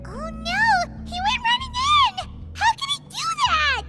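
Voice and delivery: high-pitched panicked exclamations; alarmed dismay. Acted. Companion seeing him run into danger, high-pitched alarm. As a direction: cry out in shocked disbelief.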